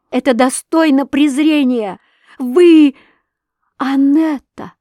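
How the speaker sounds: high, loud alarmed cry; high, loud horrified shout. A narrator's reading. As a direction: cry out in horror. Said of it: "закричала Александра Михайловна, в ужасе".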